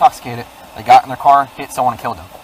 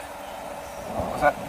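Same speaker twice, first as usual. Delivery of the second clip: ordinary talk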